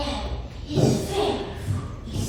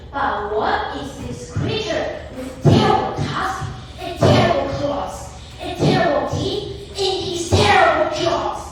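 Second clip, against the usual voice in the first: plain reading voice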